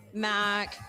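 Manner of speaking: normal talking voice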